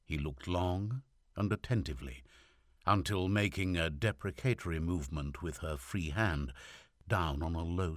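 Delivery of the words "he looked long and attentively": plain reading voice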